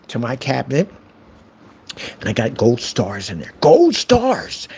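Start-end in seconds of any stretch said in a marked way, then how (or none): 3.6-4.7 high-pitched fake delight; mock excitement. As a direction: announce a kiddie prize to a troll. Mock reward for the trolls.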